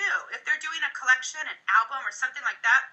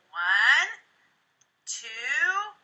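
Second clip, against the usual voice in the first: sly count; 5 semitones higher, much slower than usual. Shown as a demonstration. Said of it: "And they'll open their eyes".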